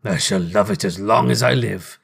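mild cry of affection, in character; delighted Scrooge. A narrator's reading. Scrooge admiring his knocker, a touch of delight. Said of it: "cried Scrooge".

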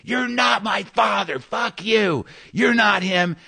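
high-pitched screaming held down in volume; imitated hysterical yelling, not full volume; mock scream. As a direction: act out someone screaming in a high, strained voice. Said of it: "she eventually would be screaming".

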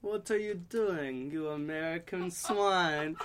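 slow hammed-up German villain snarl; mock German villain. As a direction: play a cartoon German baddie, slowly. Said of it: "I was like".

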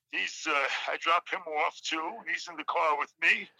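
ordinary talk, nothing marked

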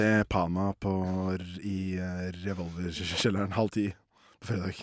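low, soft DJ-style gig plug; hushed radio promo; mock DJ plug. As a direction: announce the gig like a laid-back radio host. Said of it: "jeg høres ut som en D J".

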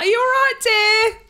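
put-on high, loud talk to the elderly; patronising carer. Teasing confused sixty-year-old relative.

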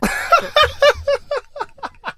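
high, loud burst of laughter; embarrassed laughter. Real, not acted. Guest owns up to an intimate question, bursting into laughter.

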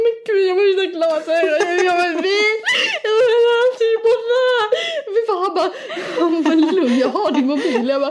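high, loud laughing sob; retold near-crying. Girlfriend near tears to boyfriend, high laughing sob. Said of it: "började jag nästan gråta".

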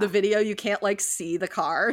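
ordinary talk

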